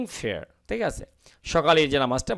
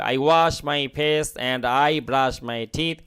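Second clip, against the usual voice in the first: slow, clear model sentence; slow model reading; much slower than usual. Shown as a demonstration. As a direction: read it out slowly for learners. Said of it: "দুটো I শুনতে খারাপ লাগবে". (ধীরে ধীরে পড়া বাক্য)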